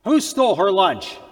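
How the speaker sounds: loud, rising angry demand; teacher hunting the lunch thief, angry; enraged teacher. Acted. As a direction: bark at the class like a furious teacher. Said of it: "Teacher was enraged".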